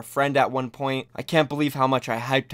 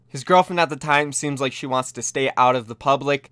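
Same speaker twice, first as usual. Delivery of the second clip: normal talking voice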